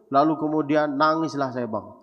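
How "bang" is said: usual speaking voice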